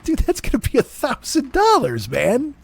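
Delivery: high, disbelieving protest; incredulous. Retold or imitated. Telling buddy Dennis an Uber costs a fortune, high disbelief. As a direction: balk at the price. Retold reaction to Uber idea.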